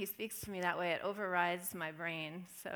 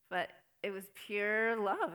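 emphatic sincere; 7 dB louder, slower than usual. Real, not acted. Emphatic declaration.

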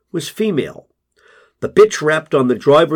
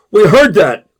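much louder, higher jeer; loud heckle; 8 semitones higher, 9 dB louder than usual. A narrator's reading. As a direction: jeer it from across the room. Group member heckles.

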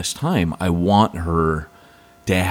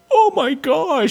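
gushing wife; slower than usual. Retold or imitated. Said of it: "Andrea's like".

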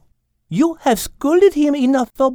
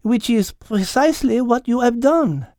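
almost matter-of-fact; 10 semitones higher than usual. A narrator's reading. Mademoiselle explains catnip to Al, almost matter-of-fact. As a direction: explain it evenly in a French accent. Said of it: "in almost a matter-of-fact tone".

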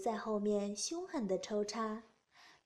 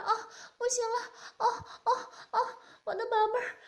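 much higher and louder, rapid ecstatic cries; loud moaning cries; 11 semitones higher, 4 dB louder, faster than usual. Acted. Woman crying out to her lover, high loud fast moaning. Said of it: "大声浪叫道".

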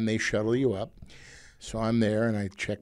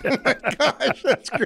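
high-pitched, slow laughing delight; 11 semitones higher, slower than usual. Real, not acted. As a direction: laugh through the words, tickled. Laughs at the fake magazine story.

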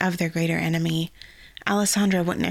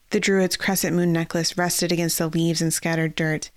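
plain reading voice

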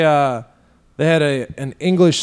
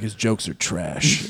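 slow, low, quieter dismissal; deadpan put-down; 6 semitones lower, 6 dB quieter, slower than usual. Retold or imitated. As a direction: drop it slowly like a verdict. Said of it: "I'm like".

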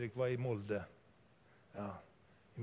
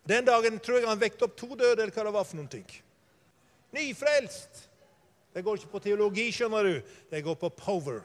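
loud, high revival preaching; fiery preaching; 7 semitones higher, 8 dB louder than usual. Acted. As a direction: preach it full force to the congregation. Preaching at full force.

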